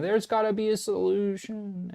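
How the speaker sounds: high, pleading whine; mock whining. Mocking panicked investors begging for a fix, in a high, mock whine. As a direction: ham up a desperate plea for rescue. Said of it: "Everyone's gonna be whining and crying".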